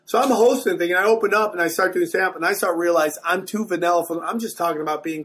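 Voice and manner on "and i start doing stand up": ordinary delivery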